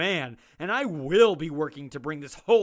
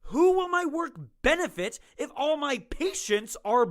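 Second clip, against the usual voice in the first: agitated protest; 9 semitones higher than usual. Acted. Doctor protesting to warden.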